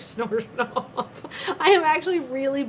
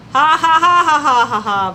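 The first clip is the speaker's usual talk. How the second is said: loud, higher staged evil laugh; villain laugh; 4 semitones higher, 9 dB louder than usual. Acted. Cheap Bond impersonator showing off, hammed-up villain laugh. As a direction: cackle like a triumphant supervillain.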